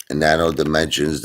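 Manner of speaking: ordinary delivery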